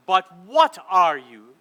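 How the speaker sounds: loud, slow demanding queen; stern queen. Acted. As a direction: boom the question as a cold queen. Queen interrogates Edmund.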